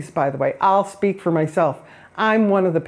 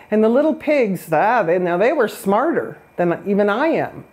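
ordinary talk

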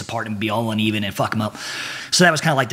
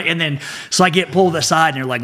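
usual speaking voice

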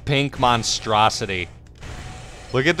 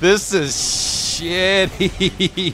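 high, drawn-out words breaking into laughter; helpless laughing; 7 semitones higher, much slower than usual. Real, not acted. Player laughing at a shitty game moment, helpless laughter. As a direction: laugh your way through it, pitched up.

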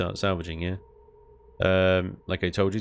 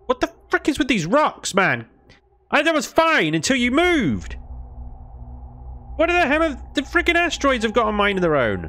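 high, loud exasperated yelling; real gamer rage, high and loud; frustrated outburst; 4 dB louder than usual. Real, not acted. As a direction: rant at the rocks, pitch way up and loud.

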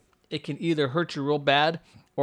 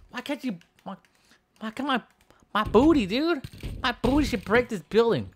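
high-pitched, frustrated complaint; exaggerated high pouty grumble; mock whining; 7 semitones higher than usual. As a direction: whine that your move failed. Move failed, complaining.

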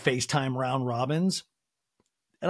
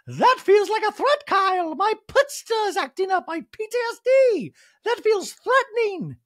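much higher and louder, a put-on alarmed voice; mock Dorit voice; 6 dB louder than usual. Host mimicking Dorit accusing Kyle, high and loud. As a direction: ham up the offended panic, high and loud.

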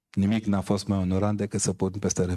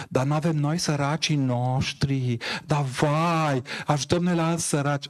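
high, whiny mock complaint, slowing on săracii; mocking whine; 6 semitones higher than usual. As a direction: parody the Facebook complainers. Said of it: "Toți mitocănei și pipițele ... au început". (vaiet batjocoritor, pe ton înalt)

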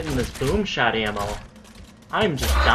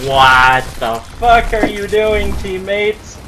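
loud, drawn-out, high-pitched scolding; exasperated yell; 5 semitones higher, 8 dB louder, slower than usual. Real, not acted. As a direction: bawl out your teammates slowly in disbelief. Yelling at his teammates in game.

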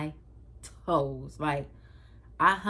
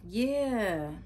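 drawn-out, higher imitation of mom's yeah; talking weird; 5 semitones higher than usual. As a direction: mimic mom's odd, empty agreement. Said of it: "She just talking weird and she keeps saying".

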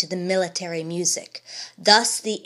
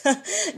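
short high mocking laugh; scornful laugh; 9 semitones higher than usual. Bullivant laughing at the old man, mocking laugh. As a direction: laugh at the old man's folly. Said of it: "said Bullivant, laughing".

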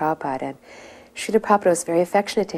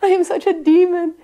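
high, loud mock wail; imitated abject confession; 12 semitones higher, 7 dB louder, slower than usual. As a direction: wail it out as the oblivious penitent. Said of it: "he was going".

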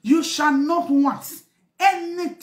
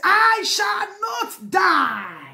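slow, higher shouted declaration; fervent shout; 5 semitones higher, much slower than usual. Real, not acted. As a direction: proclaim it slowly at full voice. Climax of crying prayer.

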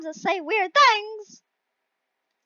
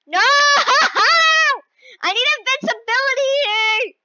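wailing plea; 7 semitones higher, 5 dB louder than usual. Real, not acted. Player begging for invincibility, loud high wail. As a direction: cry out and whine for help.

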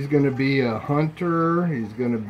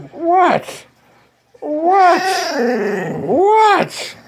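squeaky pet voice; 4 dB louder, much slower than usual. Real, not acted. Talking to the dog.

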